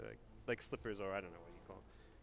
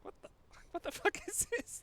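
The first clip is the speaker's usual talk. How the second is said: baffled disbelief. Retold or imitated. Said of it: "I'm just like".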